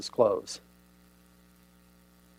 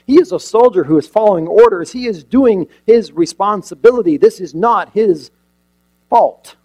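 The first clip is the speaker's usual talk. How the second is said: much louder and higher, pressing each question; full-force preaching; 5 semitones higher, 12 dB louder than usual. Acted. Preacher pleading the soldier's case to the congregation, loud and high. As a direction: drive the questions home from the pulpit.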